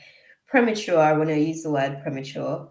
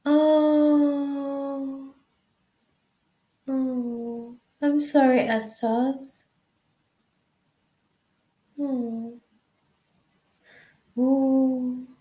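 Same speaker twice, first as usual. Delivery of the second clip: touched; 8 semitones higher, much slower than usual. Real, not acted. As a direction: melt at something sweet. Reacting tenderly to sweet chat messages.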